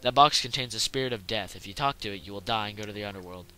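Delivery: ordinary talk, nothing marked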